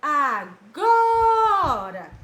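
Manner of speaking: "Agora" chanted very slowly, GO loud and held long; loud call of "Agora" with a huge long GO; drawn-out stressed syllable. Shown as a demonstration. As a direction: sing out the "go" of "Agora". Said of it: "A sílaba que a tia demora mais".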